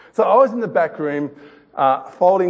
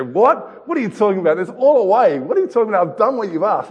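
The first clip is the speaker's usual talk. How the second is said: put-on higher, louder protesting child; upset daughter; 6 semitones higher, 3 dB louder than usual. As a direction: play the indignant little girl. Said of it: "She's really getting upset".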